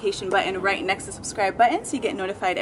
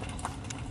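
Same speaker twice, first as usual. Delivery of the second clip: surprised gasp; 15 dB quieter than usual. Real, not acted. Romance mode revealed.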